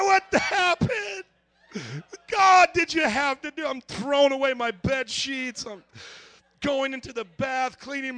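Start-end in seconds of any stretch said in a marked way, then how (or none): 0.0-3.6 high, loud, comic dismay; horrified protest. Retold or imitated. Man waking in a soiled bed protests to God, high dismayed shout. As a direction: cry out to heaven, aghast.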